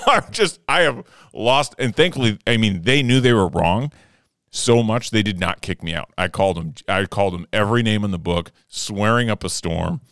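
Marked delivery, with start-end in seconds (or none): none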